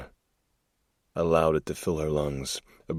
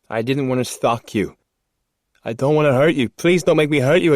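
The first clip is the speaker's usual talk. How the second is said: pleading man; 10 semitones higher, 8 dB louder than usual. Acted. Man voiced pleading in story.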